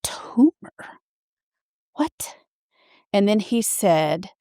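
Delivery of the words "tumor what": breathless whispered disbelief; stunned whisper. Retold or imitated. Patient hearing of a tumor, stunned whisper. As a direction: whisper it in shock. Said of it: "I was like".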